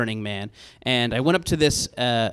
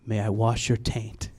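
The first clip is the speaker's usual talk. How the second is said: softer, serene, utterly polite request; deadpan namaste politeness; same reverent tone; 5 semitones lower, 6 dB quieter than usual. Retold or imitated. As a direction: ask the absurd thing with calm reverence. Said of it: "And in the same tone".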